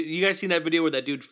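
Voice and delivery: normal talking voice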